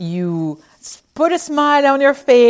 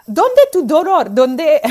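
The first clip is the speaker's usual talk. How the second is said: French-accented Spanish attempt; 6 semitones higher, 7 dB louder, slower than usual. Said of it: "trying to put an accent".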